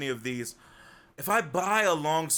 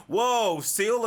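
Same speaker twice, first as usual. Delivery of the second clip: sudden gasp then a high, louder whoa; sharp intake of breath, then whoa; surprised gasp; 8 semitones higher, 5 dB louder than usual. Real, not acted. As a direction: caught off guard by loot. Gasps at finding an item.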